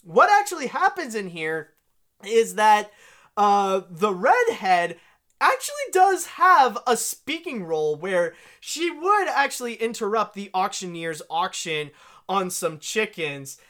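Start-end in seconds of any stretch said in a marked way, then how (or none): none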